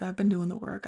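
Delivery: ordinary talk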